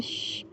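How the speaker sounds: soft sharp shush; a quiet hiss for silence. Retold or imitated. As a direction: hush someone quickly. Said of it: "उस doctor को चुप करा देते".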